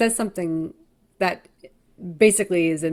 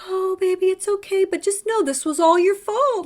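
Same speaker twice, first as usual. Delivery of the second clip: much higher, sugary mock coo; Nikki's sweet menace; 11 semitones higher, 4 dB louder than usual. Nikki to blood-covered Bear, higher sweet blaming coo. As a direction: soothe him while blaming him. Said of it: "And she's just like".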